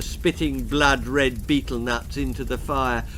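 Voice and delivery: usual speaking voice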